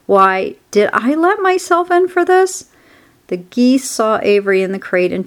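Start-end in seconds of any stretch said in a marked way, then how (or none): 0.1-2.7 high-pitched rueful grumble; exasperated complaint. A narrator's reading. Rat regretting hiding in the crate, exasperated complaint. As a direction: wail it in mild exasperation.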